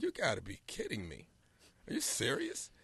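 you can't be serious, hushed; disbelief. Retold or imitated. His disbelieving reply.

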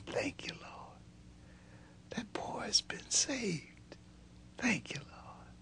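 hushed whisper of thanks; whispered prayer. Retold or imitated. Dad whispering thanks to the Lord. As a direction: breathe it like a private prayer.

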